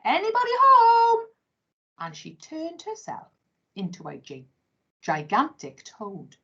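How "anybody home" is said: much higher teasing yoo-hoo; mocking sing-song call. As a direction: tease them with a high call. Taunting humans who cannot see.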